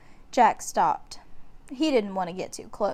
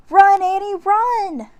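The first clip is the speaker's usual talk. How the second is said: much higher, much louder panicked yell; shouting; 10 semitones higher, 11 dB louder, slower than usual. A narrator's reading. Jack yelling at Annie to flee, panicked shout. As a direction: scream at her to run. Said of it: "cried Jack".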